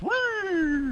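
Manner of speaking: high, hollow worm cry; giant worm impression. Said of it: "giant worm impression".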